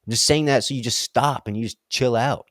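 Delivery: normal talking voice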